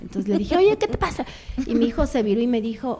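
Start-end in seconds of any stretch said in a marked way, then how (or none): 0.6-1.2 a sharp, much higher retort at the husband; snapping back. Retold or imitated. Wife snapping at husband who asked a favour, sharp and high. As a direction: bite back irritated, rising high. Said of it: "respondí mal".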